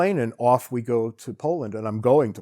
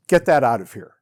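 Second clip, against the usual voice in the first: loud, dismissive order; dismissive retort; 11 dB louder than usual. Retold or imitated. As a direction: wave it away with a loud, curt refusal. Waiter rejects money in retold exchange.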